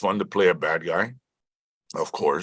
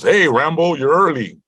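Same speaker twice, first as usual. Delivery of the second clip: loud, higher hail from a security guard; guard calling out; 10 semitones higher, 6 dB louder than usual. Retold or imitated. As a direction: call out a friendly heads-up, imitated. Guard calls out a greeting.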